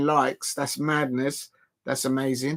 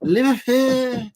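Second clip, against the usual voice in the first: mock Scouse accent; 9 semitones higher, 3 dB louder than usual. Vlogger imitating Liverpool fans cheering their lads, in a hammed-up Scouse accent. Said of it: "I might be able to do the Scousers accents".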